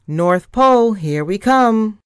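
loud, high-pitched call; excited shout. A narrator's reading. Thea announces the helicopter's landing at the North Pole, shouting. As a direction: shout it out to everyone aboard. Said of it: "Thea shouted".

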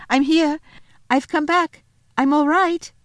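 high, loud, excited calling; shouting. Acted. Lucy announcing her return to the others, shouting. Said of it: "she shouted".

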